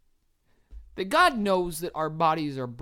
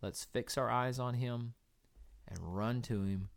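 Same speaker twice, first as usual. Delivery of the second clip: soft closing exhortation; 7 semitones lower, 9 dB quieter than usual. Real, not acted.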